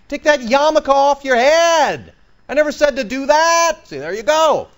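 high, loud, emphatic voice of God scolding; God preaching loudly. Acted. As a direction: thunder it out as God rebuking them. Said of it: "Can you calm down, Pastor?".

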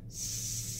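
S held out long; held s sound. Shown as a demonstration. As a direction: hold a long hiss. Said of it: "I just imitate the sound".

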